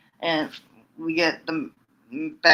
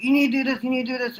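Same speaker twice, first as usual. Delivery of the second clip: higher, nagging repeated order; nagging mentor; 8 semitones higher, much faster than usual. Retold or imitated. Asuka nagging Kairi during the match, high-pitched bossy nagging. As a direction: badger her like a bossy mentor. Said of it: "It's like".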